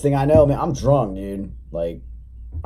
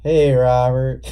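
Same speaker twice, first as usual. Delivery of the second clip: put-on slow, higher, louder punch-drunk call; dazed call; 5 semitones higher, 6 dB louder, slower than usual. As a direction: call out like you just got knocked silly. Dazed after punch, calling cousin.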